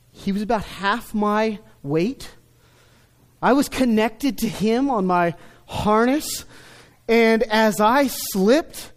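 ordinary delivery

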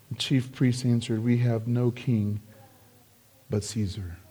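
low, soft, grave delivery; quiet and low, sinking further at the end; hushed reading. A narrator's reading. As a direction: drop right down for the grim answer. Preacher reads the priests' shocking answer.

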